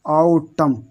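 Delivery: आउटम slowly in two beats; silent N. Shown as a demonstration. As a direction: say आउटम as "au... tam". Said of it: "इसको सिर्फ यहाँ तक पढ़ते हैं".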